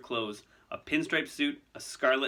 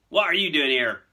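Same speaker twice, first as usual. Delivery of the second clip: loud, higher, angry demand; furious; 5 semitones higher, 10 dB louder than usual. Acted. Hagrid confronting Malfoy in his hut, furious. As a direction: confront an unwelcome intruder. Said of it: "said Hagrid furiously".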